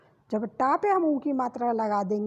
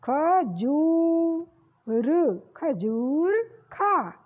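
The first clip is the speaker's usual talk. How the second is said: slow sounding out; much slower than usual. Shown as a demonstration. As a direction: stretch खजूर slowly, with a long ऊ on जू. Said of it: "जो sound आएगी वो जा की ही आएगी बढ़कर".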